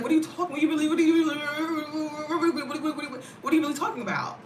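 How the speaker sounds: incredulous mock sing-song at the commenter. To a fearful commenter, incredulous sing-song mockery. Mocking a commenter's fear of America.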